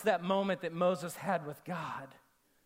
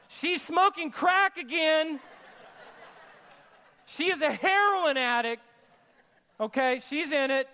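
mock alarm; 6 semitones higher, 3 dB louder than usual. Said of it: "you're looking at your wife going".

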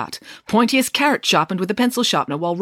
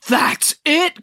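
much higher excited burst; a sudden eureka cry; 10 semitones higher, 3 dB louder than usual. Acted. Chelly leaps to her feet with an idea, crying out excitedly. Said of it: "cried Chelly".

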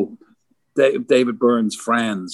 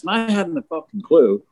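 usual speaking voice

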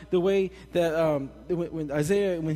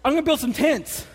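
high, loud, pumped-up voice; excited Peter; 10 semitones higher, 7 dB louder than usual. Retold or imitated. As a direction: burst out with the plan like an overexcited Peter. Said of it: "Peter was so excited".

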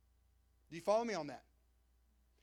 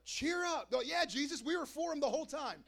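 higher, louder mock eagerness; hammed-up sudden friendliness; fake cheerful crowd; 6 semitones higher, 4 dB louder than usual.

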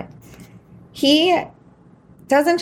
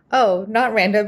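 usual speaking voice

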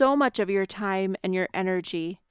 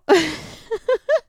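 a loud, much higher laugh at herself; high burst of laughter; 13 semitones higher, 6 dB louder than usual. Real, not acted. Catching herself getting angry again, a high self-mocking laugh. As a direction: crack up at your own temper. Laughing at her own anger.

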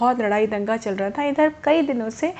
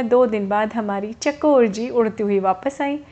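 ordinary delivery

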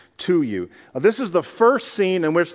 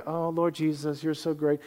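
soft, offhand praise; softer mock worship, barely meant; casual lip service; 8 dB quieter than usual. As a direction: toss it off like empty flattery. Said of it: "this is not just like".

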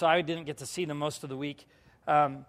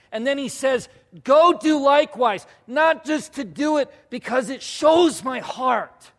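impassioned preaching; 6 semitones higher, 6 dB louder than usual. Real, not acted. Preacher tells congregation why Jesus washed feet, impassioned preaching. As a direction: drive each phrase home to the congregation.

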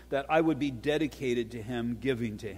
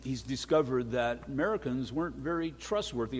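usual speaking voice

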